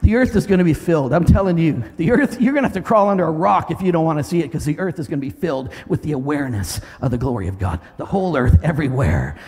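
loud, driving preaching; full-force preaching. Real, not acted. Pastor preaching to the congregation about God moving, loud. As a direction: preach it out with fire.

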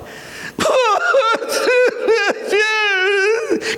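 long, high, wavering theatrical wail; mock wailing. Joshua on his face before God, wailing. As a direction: howl in hammed-up anguish.

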